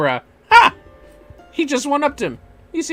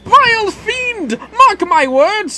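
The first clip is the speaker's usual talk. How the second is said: hammed-up noble hero swearing vengeance; heroic gentleman; 5 dB louder than usual. Voices the gentleman inspector hero.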